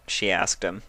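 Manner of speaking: ordinary delivery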